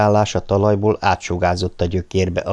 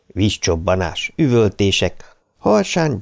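plain reading voice

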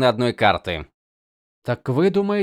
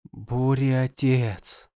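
horrified whisper; 2 semitones higher, much slower than usual. Acted. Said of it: "в ужасе прошептала девушка".

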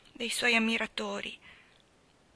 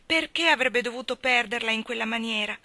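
usual speaking voice

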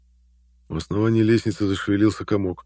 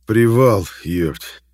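loud rough burst; blurted shout; 3 semitones higher, 6 dB louder, much slower than usual. Acted. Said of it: "выпалил Шпала".

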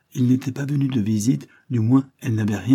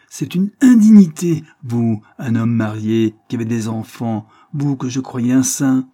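outraged tirade; 4 semitones higher, 9 dB louder than usual. Acted. Outraged sister berates her brother.

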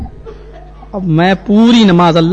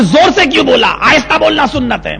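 imitated indignant scolding; 5 semitones higher than usual. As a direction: raise it, then stretch the rule out. Accuser scolds over loud amen.

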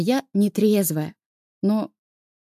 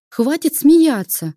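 irritated; 6 semitones higher, 6 dB louder, slower than usual. Acted. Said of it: "Я сейчас обижусь".

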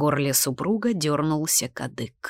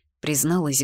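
ordinary delivery, nothing marked

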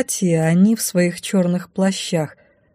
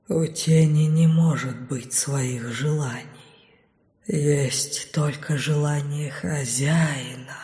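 slow, low, soft and distant; low shadow voice; 4 semitones lower, 6 dB quieter, slower than usual. A narrator's reading. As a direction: speak as an obedient shadow. Shadows answer her. (медленный низкий голос тени)

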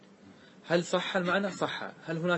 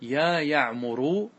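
very slow, stretched syllables, rising at the end; drawn-out demo; 3 dB louder, much slower than usual. Shown as a demonstration. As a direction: stretch out "يا يعمر" slowly to show the form. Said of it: "فقلت". (نطق بطيء ممدود)

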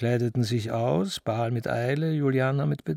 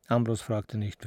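plain reading voice

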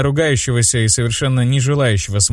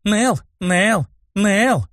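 crying out; 10 semitones higher, much slower than usual. A narrator's reading. Brunette calling the name to tease, high mock yell. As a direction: call the name in high bursts. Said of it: "неожиданно громко закричала брюнетка".

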